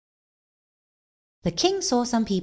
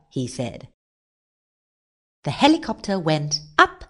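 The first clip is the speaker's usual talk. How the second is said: plain reading voice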